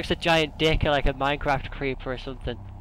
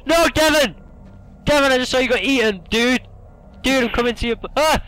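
panicked yelling; 10 semitones higher, 7 dB louder than usual. Real, not acted. Friend eaten by monster.

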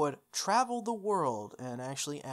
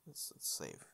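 much softer, lower self-reminder; muttered reminder; 13 semitones lower, 14 dB quieter than usual. Real, not acted. Player quietly reminding himself to save the game. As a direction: mutter it to yourself.

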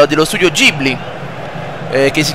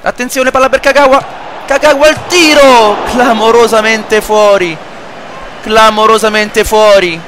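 excited commentary; 6 semitones higher than usual. Acted. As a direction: shout the chance then groan it's wide. Near miss in commentary.

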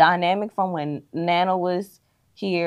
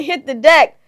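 loud, raised, half-acted yell; retold yell; 8 semitones higher, 9 dB louder than usual. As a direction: call it out loudly, retelling a warning. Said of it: "and somebody yelling".

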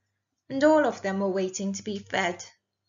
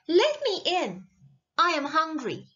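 higher-pitched plea at a door; pleading princess; 6 semitones higher than usual. A narrator's reading. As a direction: plead to be let inside.

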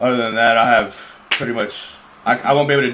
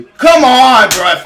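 furious yell, much higher and louder; raging gamer; 11 semitones higher, 10 dB louder, much slower than usual. Real, not acted. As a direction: rage at the referee's call. Raging at the referee.

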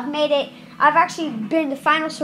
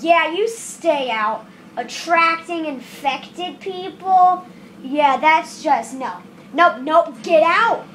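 usual speaking voice

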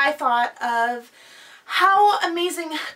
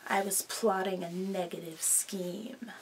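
low secretive whisper; villain's sly low whisper; 7 semitones lower, 11 dB quieter than usual. Acted. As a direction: confess the scheme in a whisper. Villain confesses scheme.

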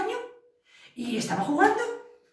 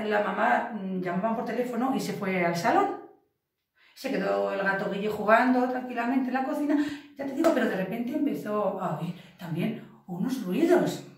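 ordinary delivery

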